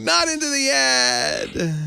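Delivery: high, slow whiny wail; slow high-pitched mock wail. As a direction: draw out the refusal, high and pleading. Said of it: "I hear Miss Lisa scream".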